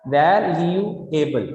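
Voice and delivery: "Valuable" drawn out slowly as well-you-able; slow, evenly separated beats on "valuable", falling at the end; three separate syllables. Shown as a demonstration. Teacher showing three syllables, "valuable" slowly split. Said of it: "इसमें तीन syllable आ गए".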